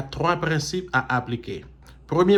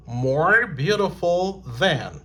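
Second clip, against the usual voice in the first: slow model; 3 semitones higher, slower than usual. Shown as a demonstration. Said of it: "models comparative slowly".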